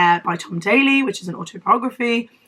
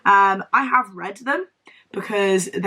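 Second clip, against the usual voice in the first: usual speaking voice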